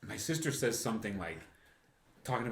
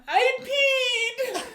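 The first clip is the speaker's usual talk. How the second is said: high squeal through laughter; laughing squeal; 9 dB louder than usual. Retold or imitated. Mom laughing in the car, squealing that she peed. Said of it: "she goes".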